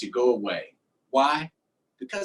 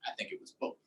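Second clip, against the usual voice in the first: soft whispered aside; 14 dB quieter, much faster than usual. Real, not acted. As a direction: breathe it out as a secret.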